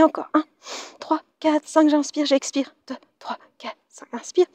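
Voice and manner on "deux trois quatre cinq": very soft, breath-led counting; whispered count. Real, not acted. Counting breaths during the exercise.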